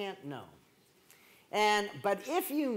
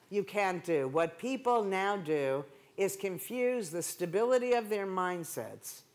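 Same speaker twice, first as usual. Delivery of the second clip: usual speaking voice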